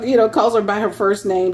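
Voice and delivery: usual speaking voice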